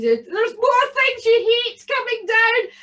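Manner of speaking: high, loud gleeful goblin voice; a bogle delighted his trick works; gloating bogle. Acted. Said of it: "And he said".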